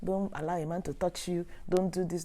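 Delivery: ordinary talk, nothing marked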